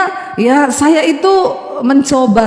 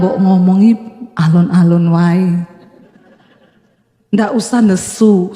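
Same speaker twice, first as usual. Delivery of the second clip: lower, slow, falling Javanese calm; low Solo husband; 5 semitones lower than usual. Retold or imitated. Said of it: "nadanya di belakang rendah".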